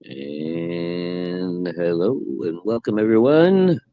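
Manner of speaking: a hint of a hammed-up show intro; announcer welcome. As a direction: open the show like a game-show host. Opens the show as host.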